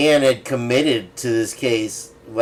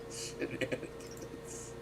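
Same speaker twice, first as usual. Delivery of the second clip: whispered word; 21 dB quieter, slower than usual. Real, not acted. Savouring a funny word after laughing.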